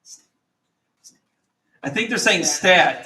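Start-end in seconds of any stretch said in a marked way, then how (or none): 0.0-1.2 hushed unvoiced student prompt; whisper. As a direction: breathe the letters like a classroom hint. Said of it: "The whisper".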